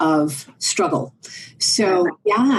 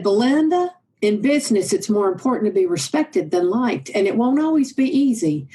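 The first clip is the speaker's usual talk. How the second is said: Oklahoma twang; 3 semitones higher than usual. Acted. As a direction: put on an Oklahoma twang, pitched up. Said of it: "it went like this in his Oklahoma twang".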